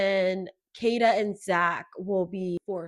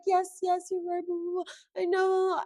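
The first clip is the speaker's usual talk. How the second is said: higher, hammed-up whimpering agreement; mock boohoo crying; 7 semitones higher than usual. Mocking Melanie crying to her mom, hammed-up and high. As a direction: imitate her fake-crying along. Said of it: "boohoo crying".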